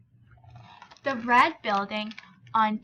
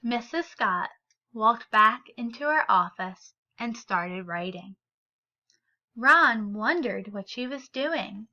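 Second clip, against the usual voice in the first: story reading. A narrator's reading. Teacher reads picture book aloud.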